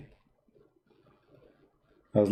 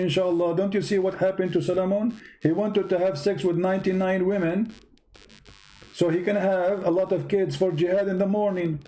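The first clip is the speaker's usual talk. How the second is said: high, sarcastic preaching voice; mocking sermon; 6 semitones higher than usual. Mocking lecture about saying Inshallah.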